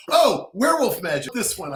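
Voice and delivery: high, loud acted outburst; excited pick. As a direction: pounce on it like an eager publisher. Publishers excitedly picking his joke proposal.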